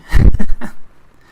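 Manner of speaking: loud, short burst of laughter. Real, not acted. Streamer amused at their own babbling, loud laugh. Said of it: "I'm just babbling".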